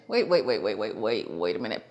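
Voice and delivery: rapid waits sinking very low; stunned double take. Retold or imitated. Customer realizing the guy cut in line, stunned double take.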